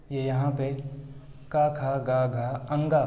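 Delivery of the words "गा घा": ordinary delivery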